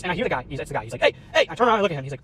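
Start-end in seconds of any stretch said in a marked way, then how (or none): 1.0-1.5 high-pitched mock call after someone; retold calling out. Man running up behind the YouTuber, imitated high call.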